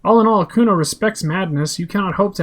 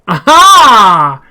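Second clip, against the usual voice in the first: loud exclamation; 5 semitones higher, 14 dB louder than usual. Acted. Suspicious cop exclaims in game.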